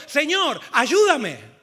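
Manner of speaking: loud cry for help; booming plea, falling at the end; desperate shout. Acted. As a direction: shout it out as a drowning man would. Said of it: "entonces gritó".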